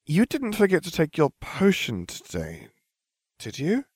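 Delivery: high, tentative, careful question; slow, hesitant. A narrator's reading. Hermione checks on the werewolf professor's potion, slow and hesitant. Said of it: "Hermione said slowly".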